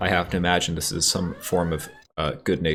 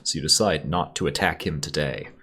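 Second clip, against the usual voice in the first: normal talking voice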